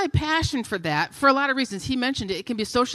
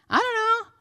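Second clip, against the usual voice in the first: put-on child voice; 11 semitones higher than usual. Child answering mother.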